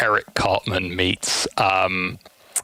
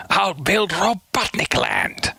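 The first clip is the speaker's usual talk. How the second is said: slow, raised villain boast; Eggman voice; 9 semitones higher, slower than usual. Pretend. Villain boasting of his theme park, slow. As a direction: savor the grand plan.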